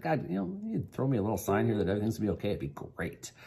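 hushed, quick prayer to God, words tumbling out. Retold or imitated. Alone in prayer, asking God for a sign, hushed. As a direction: murmur it like a private prayer.

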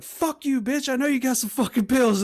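high mock-angry yelling; angry son. Son demanding pills from mom, high and angry. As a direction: imitate a drunk son cussing out mom. Acted quarrel of son and mother.

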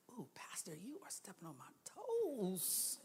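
whispered aside like a caught congregant; mock whisper. Congregant admitting the pastor hit home, in a mock whisper. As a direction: whisper it as if guilty. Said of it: "you're like".

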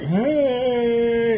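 long, high, drawn-out hungover greeting; Stu from The Hangover. Retold or imitated. Hungover friend popping out of the truck, high drawn-out greeting. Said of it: "like fucking Stu from The Hangover".

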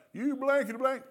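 high, loud acted cursing; loud mock cussing. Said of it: "they'll just cuss louder".

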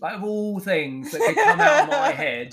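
high, loud laughing disbelief; laughing at his own words. Real, not acted. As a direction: crack up while saying it.

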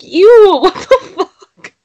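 high, loud laughing "ew"; laughing disgust. Retold or imitated. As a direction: squeal it through laughter. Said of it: "I was like".

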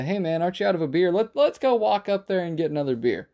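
imitating a friend's diplomatic interruption; casual defusing.

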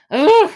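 loud, high-pitched ugh; sudden loud groan of disbelief; exasperated groan. Real, not acted. Viewer reacting to a contestant's weird dance, exasperated groan.

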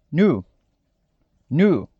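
"new" said as American "noo"; model English word. Shown as a demonstration. Teacher giving the pronunciation to students, careful English model. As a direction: pronounce "new" clearly, English vowel. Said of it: "A pronúncia é".

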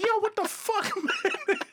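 high, laughing exclamation; cracking up in disbelief; high laughing yo what. Retold or imitated. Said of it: "I was like".

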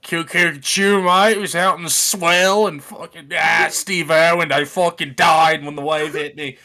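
put-on broad Aussie accent, exclaiming; Australian accent. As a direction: play an excitable Aussie surfer. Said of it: "my Australian accent's so fire".

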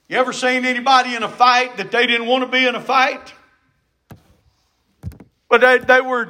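ordinary talk

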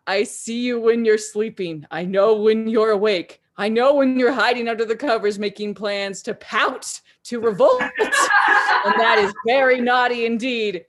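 loud gloating Santa with an evil laugh; booming Santa. Acted. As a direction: boom out the threat, cackling. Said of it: "His words boomed in echoes".

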